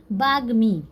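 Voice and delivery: ordinary talk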